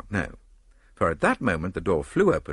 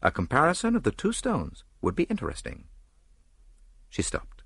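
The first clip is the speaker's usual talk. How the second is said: ordinary delivery, nothing marked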